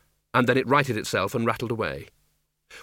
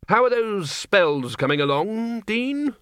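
higher, strained question; through gritted teeth; 9 semitones higher, slower than usual. A narrator's reading. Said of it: "said Ridcully through gritted teeth".

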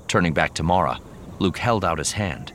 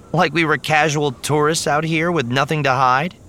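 high, louder incredulous retort; scornful snort; 10 semitones higher, 5 dB louder than usual. A narrator's reading. Mara mocking Luke's plan to walk up the middle, scornful. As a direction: scoff at a ridiculous plan. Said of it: "Mara snorted".

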